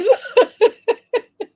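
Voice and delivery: high, loud burst of laughter. Real, not acted. Laughing at chat comment.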